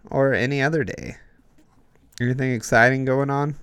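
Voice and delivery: usual speaking voice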